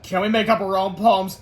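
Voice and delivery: slightly sly schoolboy; sneakily. A narrator's reading. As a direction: ask as a mildly sneaky pupil. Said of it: "asked Melvin sneakily".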